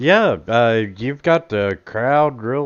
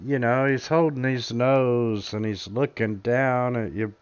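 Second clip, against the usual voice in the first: ordinary delivery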